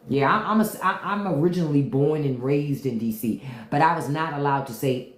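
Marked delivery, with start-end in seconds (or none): none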